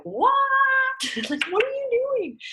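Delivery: high, mock-baffled what, breaking into a laugh. People at the table, baffled by lemon water: mock-baffled with a laugh. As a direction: play the puzzled friend, half laughing.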